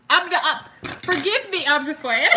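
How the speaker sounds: real giggling apology; laughing through words. Real, not acted. Laughing fit at her own joke.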